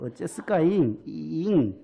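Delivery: practising a Jeolla sentence ending; imperfect Jeolla dialect. Acted. Pastor retries the phrase he can't manage, in Jeolla dialect. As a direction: try out the Jeolla lilt on the final word. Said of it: "잘 안 되네요".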